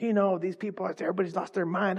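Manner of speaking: negative nurse. Retold or imitated. Old nurse griping to a coworker about everybody, mock negative. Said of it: "it was negative, always negative".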